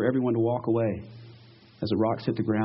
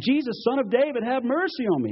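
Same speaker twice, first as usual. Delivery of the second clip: high-pitched insistent cry; imitated yell; 10 semitones higher than usual. Blind man calls over the crowd to Jesus, yelling. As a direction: call out higher, as if over a crowd. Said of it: "He yells it louder".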